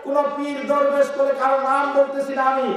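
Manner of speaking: high, loud, sustained sermon shout; full-force preaching. Acted. Preacher listing shrines nobody should bow at, full-force preaching. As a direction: hammer each phrase out to the crowd.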